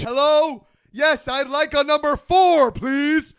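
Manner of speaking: high, loud, overly polite ordering; sing-song order into the box; drive-thru voice. Retold or imitated. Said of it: "Drive-through voice is real".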